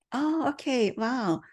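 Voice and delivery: usual speaking voice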